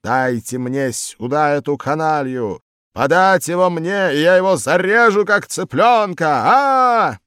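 high, loud raging bellow; loud furious threatening yell; furious roar. A narrator's reading. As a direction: roar it like an enraged tiger. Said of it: "Из школы нёсся рёв".

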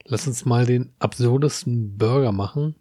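usual speaking voice

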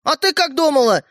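high-pitched, forceful comeback; shouted retort. A narrator's reading. Brother snapping back at sister, shouted retort. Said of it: "выкрикнул Родька".

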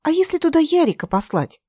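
высоко, с воодушевлением; радостная догадка; обрадованно. Acted. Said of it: "обрадовалась Катя". (радостно)

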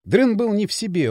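ordinary delivery

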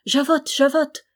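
high, loud impatient summons; calling out. A narrator's reading. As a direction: call the maid sharply from afar. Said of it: "appela-t-elle".

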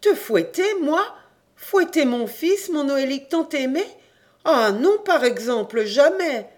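high, loud shocked refusal; a mother recoiling in outrage; indignant outcry. A narrator's reading. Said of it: "suffoquée d'étonnement et aussi d'indignation". (cri indigné d'une mère, aigu et fort)

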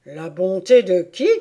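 loud, sharp royal question; demanding query from the bench; interrupting King. Acted. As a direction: cut in loudly. King cuts in on the Hatter.

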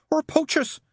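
very high, indignant add-on; snapping. A narrator's reading. Mary putting the phone down, snapping. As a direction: snap it in a high voice. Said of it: "she snapped".

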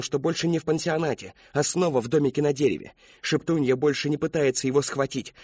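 plain reading voice

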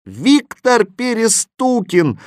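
high, slow, accusing shout; drawn-out high group-style cry; chorused answer. A narrator's reading. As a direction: shout the name like children in chorus. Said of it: "хором ответили мальчишки".

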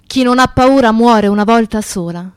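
ordinary talk

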